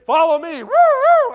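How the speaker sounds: high, loud woo-woo siren; officer leading the car, siren blaring; siren imitation. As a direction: wail like a police siren.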